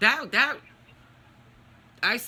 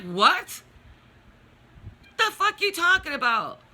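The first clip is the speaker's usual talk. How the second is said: loud "what", then high-pitched indignant challenge; retold outraged comeback; incredulous retort; 11 semitones higher, 4 dB louder than usual. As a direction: throw it back in disbelief. Retold argument with brother.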